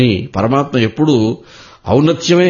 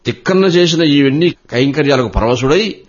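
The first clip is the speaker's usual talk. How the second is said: ordinary delivery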